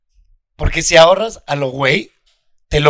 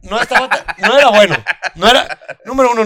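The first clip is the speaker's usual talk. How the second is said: laughing; 5 semitones higher, faster than usual. Real, not acted. Laughs at his own joke.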